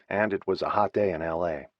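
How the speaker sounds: plain reading voice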